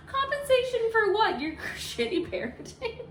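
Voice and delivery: laughing outrage. Real, not acted. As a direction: scoff it out through laughter.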